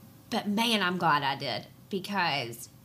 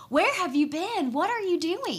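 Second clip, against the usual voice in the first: high-pitched, incredulous questioning; retold astonishment, pitched well up; astonished; 9 semitones higher, 4 dB louder than usual. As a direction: act out a friend's amazed discovery. Retelling a friend's astonished discovery.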